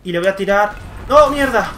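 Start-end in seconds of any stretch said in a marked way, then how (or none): 1.1-1.7 frustrated yell, higher and louder; real outburst of rage; angry shout. Real, not acted. Killed by enemy in game.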